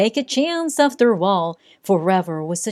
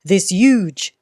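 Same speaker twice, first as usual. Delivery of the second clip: ordinary delivery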